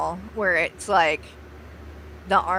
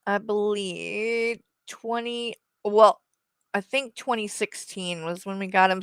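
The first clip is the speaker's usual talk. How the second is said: ordinary talk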